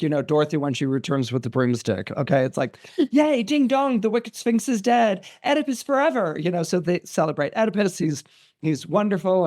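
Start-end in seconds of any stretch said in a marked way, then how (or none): 3.1-6.4 high-pitched crowd celebration; mock jubilant cheer. Thebans celebrating that the Sphinx is dead, jubilant.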